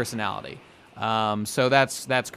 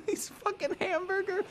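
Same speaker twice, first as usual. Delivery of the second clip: squeaky laugh through the words; giggling out the name; 7 dB quieter than usual. Real, not acted. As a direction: crack up while saying it. Laughing at own joke.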